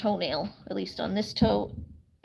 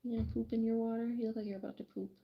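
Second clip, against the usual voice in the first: soft teasing; 8 dB quieter, much faster than usual. Real, not acted. Teasing sleepy hen softly.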